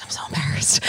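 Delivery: embarrassed whisper. Retold or imitated. Retelling own mortification to cohost, whispered. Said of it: "And I was like".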